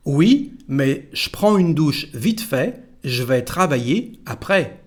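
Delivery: "je prends" as sh-pran, "je vais" as zh-vay; both contractions. Shown as a demonstration. Teacher modelling the whole sentence, "je" contracted to sh and zh. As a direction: drop both Es, keep the phrases separate. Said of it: "Again, please".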